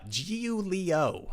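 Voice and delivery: put-on slow, drawn-out Italian accent. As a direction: stretch the name out in an Italian accent. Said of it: "Italian accent every time I see this name".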